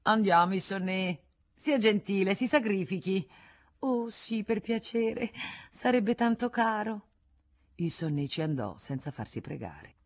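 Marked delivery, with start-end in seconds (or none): 0.0-7.1 high-pitched, wheedling entreaty; coaxing plea. A narrator's reading. A woman begging Issonet to be so kind, coaxing. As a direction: coax him sweetly in a raised voice.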